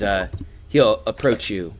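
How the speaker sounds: usual speaking voice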